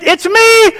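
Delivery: high acted call across the water. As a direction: call out high to identify yourself. Acting Jesus calling to scared disciples.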